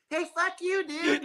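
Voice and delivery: very high mock kid voice; little kid. Little kids mouthing off in Minecraft, squeaky-high imitation. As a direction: imitate a tiny trash-talking gamer. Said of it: "there's little bitty kids".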